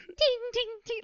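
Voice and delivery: high ringing ting noises; voicing cartoon blade clangs; imitated sword clinks. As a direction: mimic a sword hitting a wall. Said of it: "with Cloud being like".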